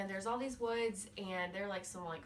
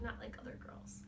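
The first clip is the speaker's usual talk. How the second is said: whispered, mocking aside; hushed imitation of a precious heroine; 9 dB quieter than usual. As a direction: slip it in under your breath. Mocking heroine's self-description in an aside.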